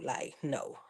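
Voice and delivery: a soft voiceless no; whispered no. Retold or imitated. Imagining the refusal she should have given, whispered. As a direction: breathe out a quiet refusal.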